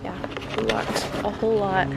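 normal talking voice

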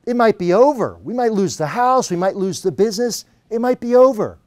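high-pitched, mounting worry; acted panic. Client tells his coach it's all collapsing, acted panic. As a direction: plead it high, retelling your own panic.

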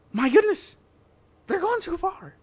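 high exasperated cry of disbelief; exasperated exclamation. Real, not acted. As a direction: pitch jumps up high, exasperated. Exasperated outburst at the meme reading.

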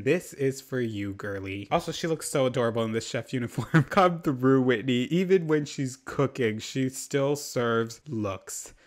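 usual speaking voice

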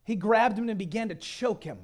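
ordinary delivery

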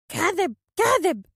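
high, loud, drawn-out accusation; slow, high-pitched bird voice; accusing phoenix. A narrator's reading. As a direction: cry it out as an outraged bird. Phoenix's accusation before he attacks.

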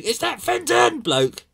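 high, loud, slow jeer with stress on that; mocking passers-by. Passers-by recognising the famous owner, high mocking jeer. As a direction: point him out gleefully to the crowd. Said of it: "With everybody going".

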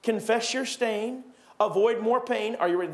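normal talking voice

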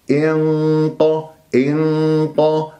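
انق drawn very slowly, nasal and emphatic; heavy ghunna. Shown as a demonstration. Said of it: "فالغنة تكون مفخمة مرتبة ثانية".

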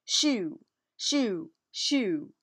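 loud, building shoos; shouting. Acted. Angelina shouting to scare Big Cat away.

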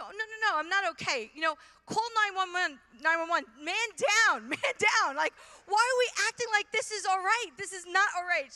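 high, loud comic panic; hammed-up "man down" distress; mock alarm. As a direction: play up the emergency as if calling for help. Retells alarmed refusal, calls emergency.

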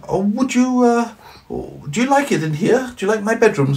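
put-on high, wide-eyed little boy. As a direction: ask it like a curious small child. Johnny voiced.

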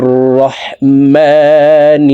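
slow, drawn-out chanted recitation; Quran recitation. Shown as a demonstration. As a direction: recite it slowly with tajweed. Basmala recited.

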